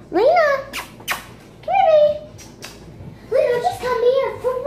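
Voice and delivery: high, singsong call to a pet; calling the dog. Real, not acted. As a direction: coax the dog over in a high voice.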